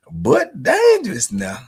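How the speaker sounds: But dangerous, with dangerous drawn out high and loud; loud drawn-out warning. Acted. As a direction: lean hard on dangerous, half joking. Repeating a warning about Tony.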